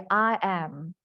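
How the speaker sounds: "I am" in crisp separate words, British style; British "I am", both words fully pronounced; British model of "I am", unlinked; British English. Shown as a demonstration. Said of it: "British English, they will say".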